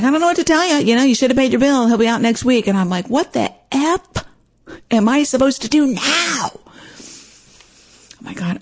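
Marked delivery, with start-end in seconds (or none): 0.0-2.7 lightly voiced clerk, pitched up, dismissive; a hint of an unhelpful phone agent; uncaring clerk. Indifferent gas company clerk quoted. 3.1-6.5 cursing at her. Retold or imitated. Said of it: "I've never cursed at anybody on the phone". 6.5-8.6 breathless whispered oh my God; hushed, slow, mortified whisper; whispered aside. Real, not acted. Shocked aside after cursing.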